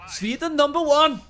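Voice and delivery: high, loud mock-Swedish accent; jokey Swedish accent, wandering towards Indian; bad Swedish accent. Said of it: "I deliberately did a bad Swedish accent".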